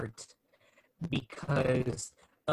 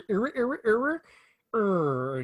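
high rising vocal scratching noises; imitated record scratch; 7 semitones higher, 3 dB louder, slower than usual. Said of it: "he goes to a record player".

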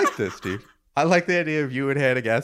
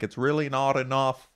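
mock New Zealand accent; 3 semitones higher, 4 dB quieter than usual. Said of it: "she's like".

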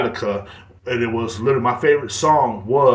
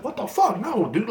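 incredulous; 11 semitones higher than usual. Retold or imitated. Bewildered reply to acted shouting.

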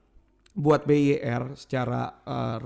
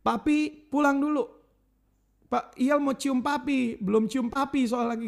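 put-on higher, slow kid's plea; imitating son; 8 semitones higher than usual. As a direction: lightly voice the child begging dad home. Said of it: "Terus dia bilang ini". (anak memohon papi pulang, ditirukan ringan)

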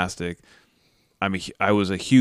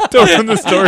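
high, loud laughing plea; laughing through a mock complaint; laughing protest; 13 semitones higher, 13 dB louder than usual. Real, not acted. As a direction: laugh it out while pleading. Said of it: "Hey, don't, don't ruin the story".

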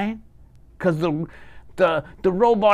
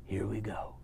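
soft unvoiced whisper; 5 semitones lower, 18 dB quieter than usual. Real, not acted. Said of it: "let's all take three big breaths".

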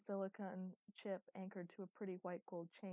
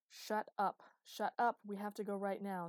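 urgent interruption; 3 semitones higher, 9 dB louder than usual. Acted. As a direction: cut them off, loud and insistent. Luke cutting off Nine in panic.

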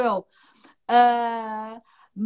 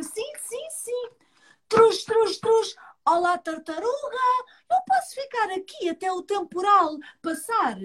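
rabbit voice; 8 semitones higher than usual. Acted. Rabbit voice in story.

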